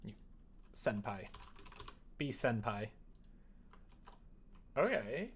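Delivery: ordinary talk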